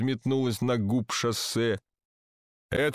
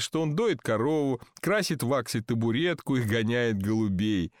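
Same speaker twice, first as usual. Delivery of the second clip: usual speaking voice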